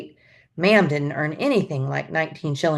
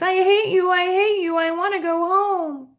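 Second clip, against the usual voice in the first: put-on sobbing child; 13 semitones higher than usual. A narrator's reading. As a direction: sob and rage at once. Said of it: "Jamie sobbed".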